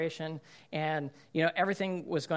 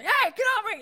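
much higher, louder mock yell at a sibling; losing it; 6 dB louder than usual. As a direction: explode like a fed-up kid shoving off a pest. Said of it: "You're gonna lose it".

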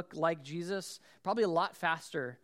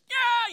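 sudden high, loud yelp; son's pained yelp; 10 dB louder than usual. Retold or imitated. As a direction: imitate a kid crying out in pain. Imitates son hurt, crying.

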